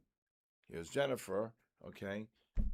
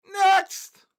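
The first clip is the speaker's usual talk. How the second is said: sharp, much higher shout; loud call; 12 dB louder than usual. Real, not acted. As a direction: bark it out to move on. Auction call, no bidder.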